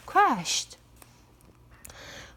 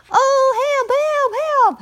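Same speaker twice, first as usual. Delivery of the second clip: high, louder panicked rooster cry; frightened cry for help; 14 semitones higher, 6 dB louder than usual. Acted. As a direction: scream for help as the fox pounces. Rooster caught by fox.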